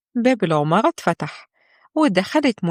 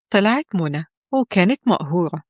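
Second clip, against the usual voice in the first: ordinary delivery, nothing marked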